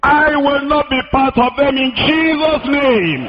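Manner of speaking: high, drawn-out preacher's proclamation; emphatic declaration. Real, not acted. Preacher disowns agitators before congregation, high drawn-out declaration. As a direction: proclaim it slowly, pitched high.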